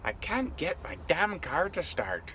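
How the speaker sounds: put-on botched Irish accent, slow and high; failed Irish accent. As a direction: stretch the words in a bad Irish voice. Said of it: "but it ain't Irish".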